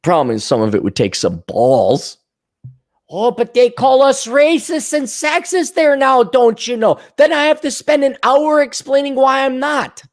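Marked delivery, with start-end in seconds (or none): 3.1-10.0 high, whiny complaining; hammed-up whining; mock whiny Republican. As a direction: whine like a put-upon politician. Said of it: "mocking Republican complaint".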